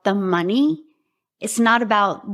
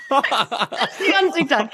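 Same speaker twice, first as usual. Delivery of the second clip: laughing echo; 4 semitones higher than usual. Real, not acted. Guest echoing the host's quip while laughing. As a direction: repeat it through a real laugh.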